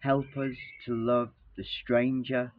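ordinary talk

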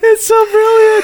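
very high, tearful laughing squeal; crying with laughter. Real, not acted. Podcaster delighted by the terrible accent, crying with laughter. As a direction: squeeze it out through real helpless laughter. Helpless laughter after the clip.

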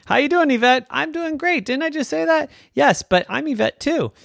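put-on high, lively girl chatting with herself; Yvette's inner voices. Yvette talking to her other self in her head, high playful voices. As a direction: bounce along in a high-pitched character voice.

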